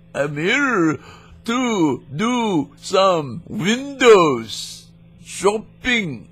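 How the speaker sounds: high, slow hammed-up Indian accent; exaggerated Indian accent, very slow and high; mock Indian accent. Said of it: "let me get Apu".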